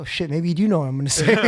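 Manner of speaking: fast, laughing through the words. Real, not acted. Retelling being caught out by his brother, laughing. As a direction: crack up as you admit it.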